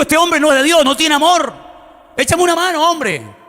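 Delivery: high-pitched, exasperated complaint; indignant protest. Retold or imitated. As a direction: protest as if wronged and pleading for help. Protesta retando al hombre que lo encierra. (protesta indignada, en tono agudo)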